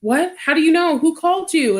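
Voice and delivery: high-pitched, bewildered questions, retold; acted-out parental shock; startled disbelief. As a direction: imitate a shocked dad firing questions. Retold father reacting in disbelief.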